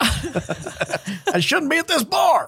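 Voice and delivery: high, slow caught-out voice; mock guilty voice. Cohost imitating someone caught at a bar on judgment day, high and slow. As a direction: play the sinner caught at the bar, drawn out. Voicing dad at second coming.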